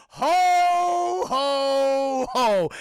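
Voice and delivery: big, high, loud Santa laugh; booming Santa ho ho ho. Retold or imitated. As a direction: belt out a jolly Santa ho ho ho at the door. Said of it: "He did the fucking ho, ho, ho from the Christmas Story".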